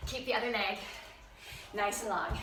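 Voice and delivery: panting between words; breathless from the lunges; out of breath. Real, not acted. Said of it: "I'm panting already".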